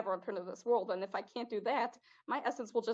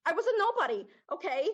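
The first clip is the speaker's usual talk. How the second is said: high, loud defensive snap; sudden loud defensive outburst; angry outburst; 6 semitones higher, 7 dB louder than usual. Acted. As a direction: blurt it out, stung. Spirit snaps about his past.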